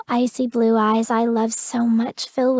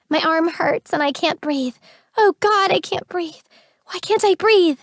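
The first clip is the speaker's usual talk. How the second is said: high breathless panic; gasping in terror; panicked; 6 semitones higher than usual. A narrator's reading.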